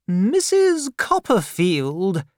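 put-on high, slow, imperious old lady voice; a severe aunt demanding at the door; stern old aunt. Aunt Betsy questions the frightened widow, high and slow.

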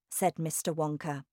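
plain reading voice

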